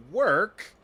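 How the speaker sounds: high, loud disbelieving question; incredulous. Real, not acted. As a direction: balk at the very idea. Incredulous at Shin calling it work.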